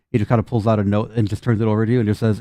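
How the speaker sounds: ordinary talk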